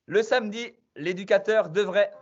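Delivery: quiz-master hype; quiz host announcement. Acted. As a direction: hype the players as you read out the question. Quiz host announcing results and question.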